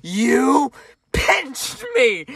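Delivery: mock-childish loud complaint; yelled accusation. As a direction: shout it like a kid tattling on mom. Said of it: "I yelled a little bit too loud".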